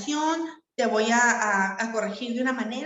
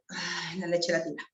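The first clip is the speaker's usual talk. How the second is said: exasperated sigh; 5 semitones lower, 7 dB quieter than usual. Retold or imitated. Mamá ve caer la leche.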